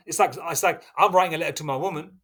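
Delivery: usual speaking voice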